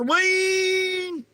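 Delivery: shrill high holler of ween. Acted. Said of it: "supposed to holler".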